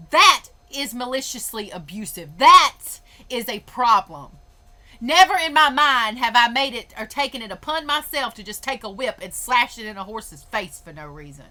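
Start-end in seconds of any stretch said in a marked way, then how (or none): 0.1-6.9 indignant. Real, not acted. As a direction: hammer each word home in anger. Angry rant about horse abuse.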